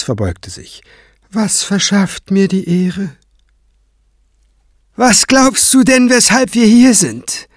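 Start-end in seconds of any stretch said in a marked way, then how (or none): 1.3-3.1 higher-voiced, courteous question; polite cleric. Kranz asking his visitors why they came, higher and courteous. 5.0-7.5 high, louder mocking question; sneering. Craven mocking Kranz before the high priest, high and sneering. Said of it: "fragte Craven höhnisch".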